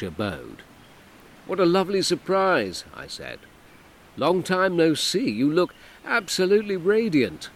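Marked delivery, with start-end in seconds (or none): 1.5-2.8 higher, louder breezy greeting; overly cheerful hello; forced cheer. A narrator's reading. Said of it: "as cheerfully and casually as". 4.2-7.5 higher, louder breezy compliment; too cheerful flattery; forced cheer. A narrator's reading. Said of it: "as cheerfully and casually as".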